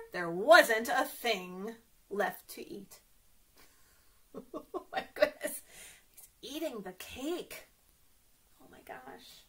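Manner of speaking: ordinary talk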